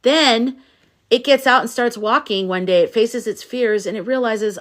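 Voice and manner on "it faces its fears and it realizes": usual speaking voice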